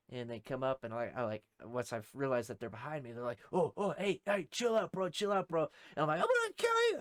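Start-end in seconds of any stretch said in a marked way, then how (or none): 3.5-5.7 high, hurried pleading to back off; imitated "whoa, easy" urgency; urgent calming. Retold men calming him down. 6.2-7.0 very high, loud mock screech; retold terrified outburst; panicked threat.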